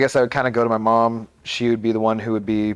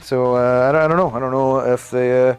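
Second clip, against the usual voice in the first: higher-pitched, hammed-up Canadian accent; horrible Canadian accent; 4 semitones higher than usual. Streamer joking about Canadian grocery stores, in a fake Canadian accent. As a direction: put on a bad cartoon Canadian accent. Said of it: "horrible Canadian accent".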